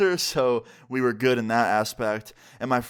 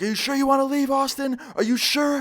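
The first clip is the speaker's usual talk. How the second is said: much higher, louder imitation of a frantic girlfriend; screaming; 13 semitones higher, 4 dB louder than usual. Girlfriend at the car window with a knife, screaming. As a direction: shriek it as the enraged ex. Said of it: "just screaming".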